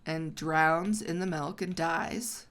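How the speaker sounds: ordinary delivery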